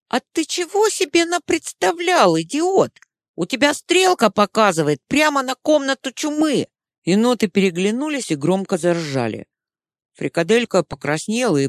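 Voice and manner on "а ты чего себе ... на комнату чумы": high, jeering raccoon; mocking raccoon voice. A narrator's reading. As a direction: sneer at the idiot. Raccoon mocks the idiot.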